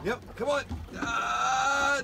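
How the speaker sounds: usual speaking voice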